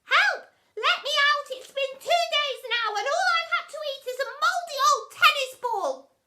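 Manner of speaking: very high, loud plea; crying out. A narrator's reading. Boy trapped in cupboard begging release, crying out. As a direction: wail it high and desperate. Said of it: "cried the boy".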